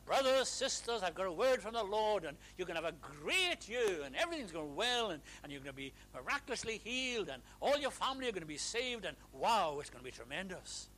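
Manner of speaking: booming mock prophecy; false prophet. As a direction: overblown promise of blessing. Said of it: "I would be a false prophet if I said to you".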